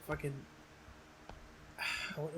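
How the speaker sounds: ordinary talk, nothing marked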